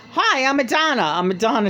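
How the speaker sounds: high mock [name] introducing herself. [name] greeting Richard E. Grant, high mock voice. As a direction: imitate [name]'s high hello. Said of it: "how I'm making her voice is how, exactly how he described".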